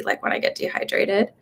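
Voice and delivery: usual speaking voice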